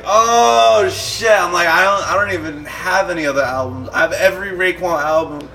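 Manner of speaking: high, loud "Oh shit" of sudden alarm; shocked panic. Retold or imitated. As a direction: blurt it out caught in a huge mistake. Realizes he dissed [name].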